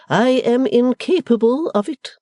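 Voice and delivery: high, loud stately matron command; Mrs Wilfer's order. Acted. Stately mother orders the servant onward, imperious. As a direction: proclaim it like a grand lady. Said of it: "said the impressive lady".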